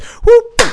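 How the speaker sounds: high, loud whoop for the bike going down; playful mock whoop; whoop sound effect. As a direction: yelp it like a sliding fall.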